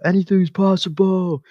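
high, loud, slow overblown yell; comedy-sketch imitation, shouted and stretched; mock Garnett. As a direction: ham it up like a parody of a champion's rant. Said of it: "they go".

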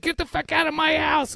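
high-pitched imitation of the wife throwing him out; mock wife yelling. Husband mimics his wife in a shouting match, high mock voice. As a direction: squeal it like she's kicking him out.

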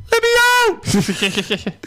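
high, slow, pleading puppet squeak; Puppet George voice. Retold or imitated. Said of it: "In the Puppet George voice".

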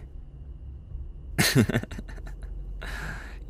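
a quiet airy laugh to herself; soft breathy laugh. Acted. Caught smiling by a friend, soft airy laugh. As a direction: let a hushed laugh slip out. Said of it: "Why am I smiling?".

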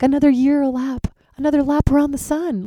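high, gleeful celebration; sing-song like it's your big day; birthday glee. Retold or imitated. Celebrating her birthday to her friend, high and gleeful.

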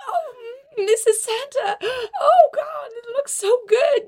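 squealing with delight. Acted. Elf overcome with delight.

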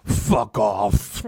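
very low, loud imitation of a surly dad; deep-pitched, forceful brush-off; mock dismissal. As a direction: growl a curt rejection, hammed up. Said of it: "He's like".

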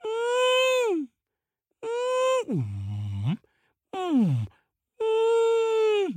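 high wordless moans; mysterious wailing sound. A narrator's reading. Crow listens to the valley sound, eerie high wail. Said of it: "the crow listens and he hears".

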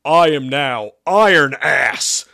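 slow, loud, booming proclamation; roar. Acted. Demon announces his new iron form, roaring. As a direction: roar it out, stretching the words. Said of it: "Isaac roared".

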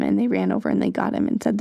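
ordinary talk, nothing marked

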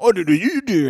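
put-on loud, high gibberish UK accent; wildly overdone British accent; insane accent. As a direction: exaggerate the accent into nonsense. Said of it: "more insane".

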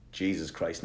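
ordinary delivery

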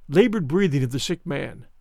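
plain reading voice

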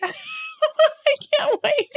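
high, slow giggly repeat; giggling. Real, not acted. Host repeating her excitement to listeners, giggling high. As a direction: laugh your way through the words.

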